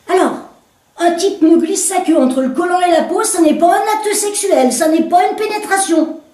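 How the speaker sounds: woman protesting to the commissaire; indignant woman. Acted.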